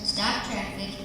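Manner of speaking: plain reading voice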